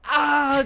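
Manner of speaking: high, loud yelp, imitated; imitating a second kid's scream; mock pain yelp. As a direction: yelp as you land on a sharp toy. Other kid steps on bones.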